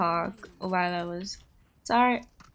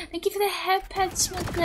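high-pitched, gushing thank-you after a gasp; delighted thanks; 9 semitones higher than usual. Real, not acted. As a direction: squeal your thanks to a viewer. Thanking a viewer for gift.

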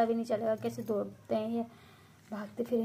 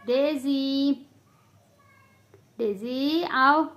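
coaxing call; 3 semitones higher, 8 dB louder, much slower than usual. Real, not acted. Coaxing Daisy to come.

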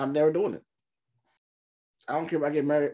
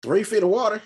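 loud, higher-pitched disbelief; incredulous echo; 7 semitones higher, 6 dB louder than usual. Real, not acted. Film viewer questions the shark fact, incredulous. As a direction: throw it back as a loud, startled question.

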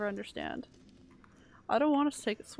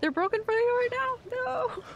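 surprised high voice; 13 semitones higher, 5 dB louder than usual. Real, not acted. Surprised at chat.

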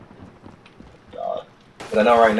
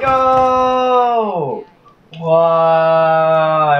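anguished cry; 5 semitones higher, 4 dB louder than usual. Real, not acted. Missed chance.

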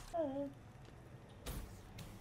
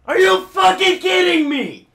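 high, slow, screamed disbelief; furious shout; 15 dB louder, slower than usual. Real, not acted. Gamer fails again.

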